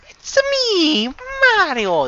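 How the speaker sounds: high, hammed-up Italian accent. Greeting the chat as Mario in an Italian accent. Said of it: "I should be speaking in an Italian accent".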